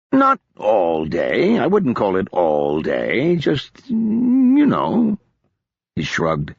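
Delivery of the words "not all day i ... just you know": slow, louder hedging; a touch of sheepish evasion, drawn out; evasive drunk. Acted. Odric dodging Marcus's question, slow and evasive.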